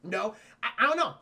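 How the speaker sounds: ordinary delivery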